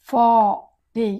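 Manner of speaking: ఫార్టీ drawn out as faa, tay; slow teaching model of ఫార్టీ with "tay" ending; syllable-by-syllable. Shown as a demonstration. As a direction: stretch both syllables of ఫార్టీ apart. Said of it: "కానీ ఫోర్ట్ కాదు అది".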